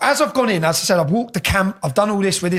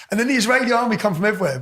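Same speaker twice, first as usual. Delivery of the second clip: ordinary delivery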